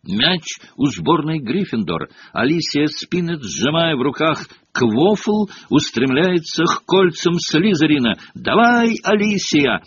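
eager play-by-play, rising into a cheer; building sports call; match commentary. A narrator's reading. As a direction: commentate the attack, urging the player on. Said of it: "начал комментарий Джордан".

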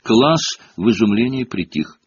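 ordinary delivery, nothing marked

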